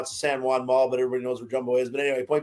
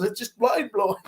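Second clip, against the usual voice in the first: a hint of an Argentinian accent, higher; light Argentinian accent; 6 semitones higher than usual. Retelling his rugby friend's hockey awe, light Argentinian accent. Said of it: "And say that with an accent".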